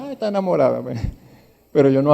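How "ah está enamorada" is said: lightly voiced churchwoman in love; a hint of a sighing sister in the pews; mock swooning sister. Pastor mimicking the church sisters, lightly swooning. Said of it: "Así hablan las hermanas".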